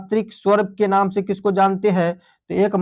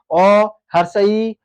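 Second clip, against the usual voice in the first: slow listing; much slower than usual. Shown as a demonstration. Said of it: "तो चार गो यहाँ पर होता है हर्ष स्वर".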